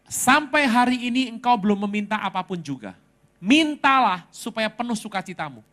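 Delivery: loud, ringing verse delivery; declaimed scripture. Retold or imitated. Pastor relays God's verse received in prayer, declamatory. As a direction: proclaim it as God's own word.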